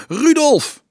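very high, loud shout; calling out. A narrator's reading. Peter calling Rudolf above the singing, loud high shout. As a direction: holler the name over the singing. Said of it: "hoorde hij roepen, boven het gezang uit".

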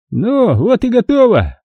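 put-on high, slow satisfied old man coo. A narrator's reading. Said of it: "удовлетворённо проворковал старик".

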